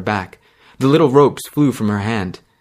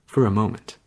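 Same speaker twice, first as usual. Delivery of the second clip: ordinary delivery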